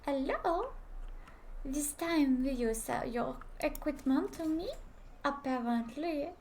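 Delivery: put-on French accent. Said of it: "I must speak in French accent".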